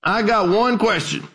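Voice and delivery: a hint of an old preacher calling out, high. Old preacher interrupting a lecturer from the back, lightly acted. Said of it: "an old dark-skinned preacher".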